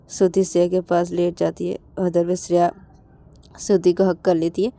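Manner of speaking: ordinary delivery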